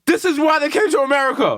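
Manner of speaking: high, mock-emotional proclamation; hammed-up patriotic fervor; impassioned declaration. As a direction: declare it like a rousing speech. Mock-emotional immigrant parents rant.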